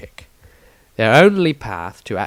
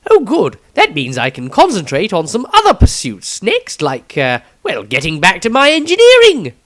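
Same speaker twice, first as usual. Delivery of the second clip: higher and louder, bright with relief; eager young Maestro; 7 semitones higher, 7 dB louder than usual. Acted. Maestro tells the Patriarch he'll get back to engineering, higher and louder. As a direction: chirp it out, glad it is over.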